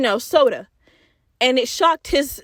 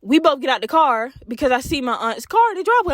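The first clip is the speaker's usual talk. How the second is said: ordinary talk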